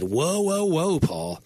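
mock objection. Voices Paul's alarmed opponents objecting.

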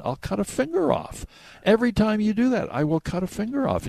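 lightly voiced warning, deadly serious; grim threat. Fiddler warning his friend, mild mock menace.

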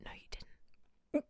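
slow, breathless whisper; hushed whisper; whispered denial. Retold or imitated. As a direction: hiss the denial back at them. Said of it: "And I was like".

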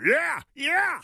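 high shouted yeah yeah, pumped up; mock macho father cheering along; eager dad cheer. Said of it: "makes every moderately masculine father go".

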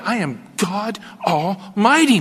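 slow, solemn "I am God Almighty"; God proclaiming his name to Abram, slowly. Acted. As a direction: draw out "Almighty" slowly, as a grand declaration. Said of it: "He comes to him with this statement".

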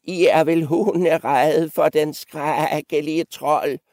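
put-on high, slow old-goat taunt. Old goat goading the three about the troll, high and slow. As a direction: draw it out high, goading them. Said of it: "fortsatte den gamle gedebuk".

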